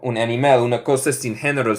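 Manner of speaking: ordinary delivery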